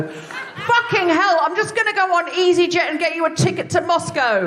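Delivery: a high, laughing rant at Chekhov's sisters; exasperated laughing outburst. Real, not acted. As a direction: blurt it out, fed up and amused.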